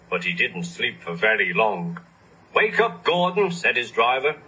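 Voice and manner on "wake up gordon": calling out. Acted. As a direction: call him awake. Driver calls Gordon.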